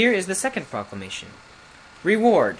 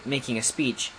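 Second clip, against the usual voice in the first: usual speaking voice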